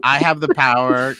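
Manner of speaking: loud proclaiming of the line, stress on have; hammed-up heroic cry. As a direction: shout it out like He-Man raising the sword. Declaiming the He-Man line.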